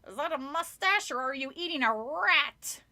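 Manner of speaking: jab the insult with a nasty rasp; sneering raspy puppet voice; nasty dummy. A narrator's reading. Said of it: "he said nastily".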